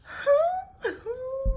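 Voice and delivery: very high comic whimper; mock crying noise. Telling how she nearly cried at the door, high mock whimper. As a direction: squeak out a pretend sob. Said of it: "I wanted to freaking cry".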